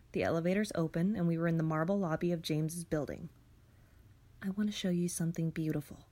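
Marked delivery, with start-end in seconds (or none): 4.4-6.1 soft breathy whisper. Acted. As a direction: lean in and whisper the offer. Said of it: "he said".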